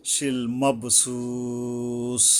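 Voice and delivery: শিল মাবসুস very slow, long final u; three-alif stretch. Shown as a demonstration. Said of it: "তিন আলিফ টানতে হবে". (শিল মাবসুস-এর সু তিন আলিফ টেনে পড়া)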